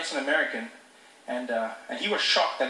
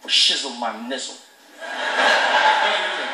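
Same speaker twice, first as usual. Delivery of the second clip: usual speaking voice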